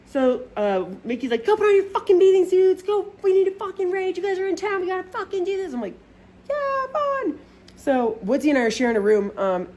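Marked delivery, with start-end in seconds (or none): 1.5-5.7 high-pitched hyped-up imitation; rally the guests in a high, wild voice; rowdy party host. 6.5-7.4 very high, squeaked reply; squeaky fake enthusiasm. Feigned enthusiasm answering the host.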